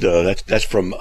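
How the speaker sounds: ordinary talk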